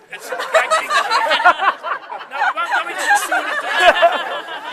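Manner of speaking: laughing. Real, not acted. Laughing through a joke in speech.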